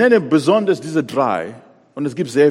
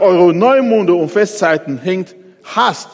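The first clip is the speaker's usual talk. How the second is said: God's anger; 5 semitones higher, 6 dB louder, slower than usual. Acted. God voiced, hating feasts.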